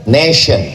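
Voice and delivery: "nation" said nay-shun, with sh; pronunciation demo. Shown as a demonstration. Teacher showing students how "nation" is said. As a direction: read "nation" with a shin sound. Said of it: "cara bacanya nation".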